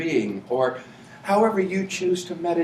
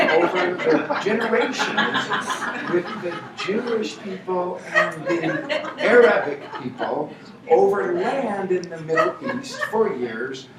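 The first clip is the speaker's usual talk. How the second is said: laughing mock euphemism; 4 semitones higher, 3 dB louder than usual. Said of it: "So I have to say things like".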